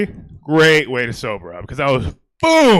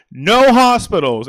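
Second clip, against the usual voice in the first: slow, louder firm refusal; insisting; 6 semitones higher, 4 dB louder, slower than usual. Retold or imitated. Comic retells refusing the ambulance, slow firm insistence. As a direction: plant your feet and refuse. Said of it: "So I'm like".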